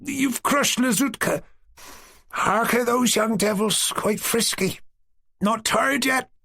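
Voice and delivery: put-on high, slow drowsy old woman's voice; sleepy old woman. A narrator's reading. Old woman in the wagon scolding the girls sleepily. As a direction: grumble half-asleep from the wagon. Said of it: "came the old woman's sleepy voice".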